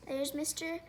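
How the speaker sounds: ordinary delivery, nothing marked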